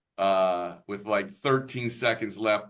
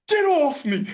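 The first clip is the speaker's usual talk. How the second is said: much higher, louder angry shout; heated snap; 7 dB louder than usual. Retold or imitated. Dad shaking off his wife's hand at the game, much higher, louder angry shout. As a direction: bark it in the heat of the moment. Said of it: "the heat of the passion".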